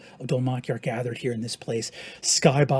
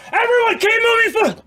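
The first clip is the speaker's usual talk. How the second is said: much higher, louder urgent cry; shout; 10 dB louder than usual. Acted. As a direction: cry out to keep them running. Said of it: "looking up and saying".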